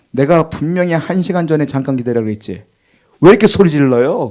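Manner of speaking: ordinary delivery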